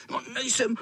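high, whiny chattering cat voice; high freezing-cat stutter; shivering tomcat. Acted. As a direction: stammer and shiver with indignant cold. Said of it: "Drkotal zuby".

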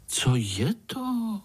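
put-on high, slow, childlike question; curious little spider. A narrator's reading. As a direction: ask unashamedly, pitched high and drawn out. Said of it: "Pája se nestyděl zeptat". (zvídavý pavouček, vysokým hláskem)

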